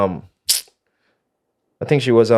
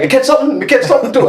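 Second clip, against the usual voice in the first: excited call; 13 semitones higher, faster than usual. Retold or imitated. Excited about fish on lines.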